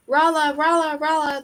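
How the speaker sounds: high repeated echo of a shout; echoing call. A narrator's reading. Echoes replying to Rumo's call from the cavern roof, high echo. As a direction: echo the name back from overhead, fading. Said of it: "the echoes replied from overhead".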